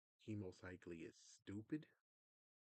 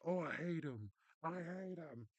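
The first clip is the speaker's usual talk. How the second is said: high, loud sneering imitation of a complainer; mock whiny complaint; 8 semitones higher, 7 dB louder than usual. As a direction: whine it like a spoiled critic. Mocking the YouTuber.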